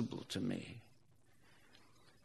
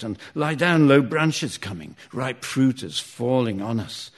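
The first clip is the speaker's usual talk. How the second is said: loud urgent warning call; shouting; 8 dB louder than usual. Acted. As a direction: yell the warning to the back. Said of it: "I shout".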